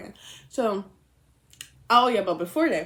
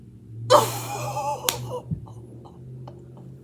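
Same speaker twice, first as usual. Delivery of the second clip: sharp unvoiced gasp; shocked gasp. Real, not acted. Realizing she said his name, a sharp gasp. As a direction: gasp in real horror. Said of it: "Oh my God".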